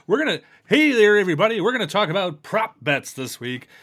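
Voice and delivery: booming sports radio host. Pretend. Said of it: "you got your radio voice down".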